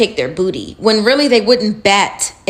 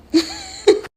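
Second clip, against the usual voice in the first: a short, sharp, high laugh; high squeal of a laugh. Real, not acted. Laughing at her own remark.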